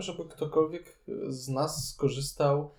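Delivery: ordinary talk, nothing marked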